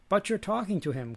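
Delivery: high-pitched, mildly acted protest; crying out. A narrator's reading. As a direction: cry out in objection. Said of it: "cried Baal".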